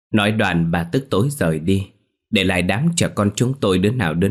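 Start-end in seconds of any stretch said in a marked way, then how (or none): none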